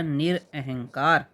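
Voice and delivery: निरअहंकार said slowly, syllable by syllable, नि-र-अहं-कार; drawn out carefully for the class; slow spelled-out word. Shown as a demonstration. Teacher to students, slow syllable-by-syllable pronunciation. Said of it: "क्या होता है?".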